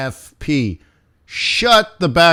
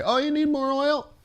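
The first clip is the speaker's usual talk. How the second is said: high, softer mock-obliging voice; airy imitation of instant help; mock helpfulness; 12 semitones higher, 6 dB quieter than usual. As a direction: lift it high and light, as if it were easy. Mimicking an easy instant fix.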